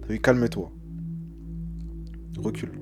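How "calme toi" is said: ordinary talk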